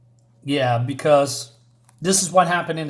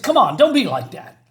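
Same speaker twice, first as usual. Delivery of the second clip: higher, louder protest; mock pleading; 9 semitones higher, 5 dB louder than usual. Night Owl pleading with Rorschach, a higher louder protest. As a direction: plead with him to calm down.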